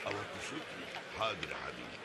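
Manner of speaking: sarcastic hush. Acted. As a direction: pretend to comply, dripping irony. Father mocks request to lower voice. (همس ساخر)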